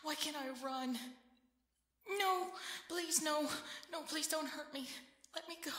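high, loud, desperate begging; terrified pleading. Acted. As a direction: beg for your life, voice up high. Begging attackers not to hurt.

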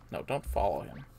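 normal talking voice

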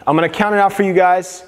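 ordinary delivery

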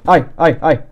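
sudden loud startled ai; startled yelp. Real, not acted. Gamer yelping when an enemy suddenly hits him. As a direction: jolt out the cries.

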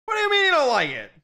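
high, fast disbelief; can't believe what they heard; incredulous. Real, not acted.

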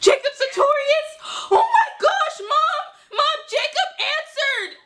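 high-pitched hammed-up squealing; crazy fangirl. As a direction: shriek for mom like a starstruck fan. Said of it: "pretending like I'm some crazy fangirl".

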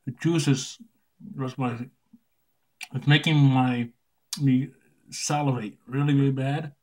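talking around a lollipop; sucking candy while speaking; lollipop in mouth. Real, not acted. Seen 1: Lollipop stick visible at lips throughout; R1-R2 hold it outside mouth.

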